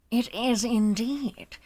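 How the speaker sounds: slow, drawn-out character voice; suave character voice. Acted. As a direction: stretch each word with theatrical intrigue. Demonstrates a game character's line.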